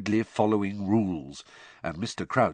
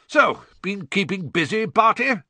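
high, loud, chummy greeting; breezy; 11 semitones higher, 6 dB louder than usual. A narrator's reading. Bagman making small talk with Barty, breezy. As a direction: bounce it out cheerily.

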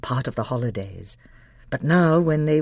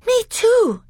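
very high, louder small cry; frightened girl; 7 dB louder than usual. Acted. Lucy agrees with the others, frightened. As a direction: whimper it out.

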